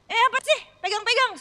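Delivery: high, loud startled snap; startled angry retort. Retold or imitated. Retelling a startled snap at a stranger who grabbed him. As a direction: snap at the stranger touching you. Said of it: "saya kaget, saya langsung marah".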